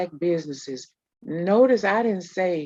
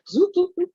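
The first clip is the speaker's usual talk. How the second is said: swoop noises; 13 semitones higher, 4 dB louder, faster than usual. Retold or imitated. Kids rushing into action.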